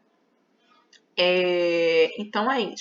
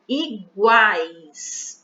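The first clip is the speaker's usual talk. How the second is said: Iguais, louder and held; stress on A; 5 dB louder than usual. Shown as a demonstration. Teacher shows the strong vowel in Iguais. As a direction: lean on the A in Iguais. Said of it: "a vogal que a gente escuta com mais força".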